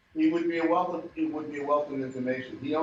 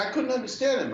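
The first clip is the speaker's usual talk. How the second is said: put-on girlfriend's voice; 12 semitones higher, faster than usual.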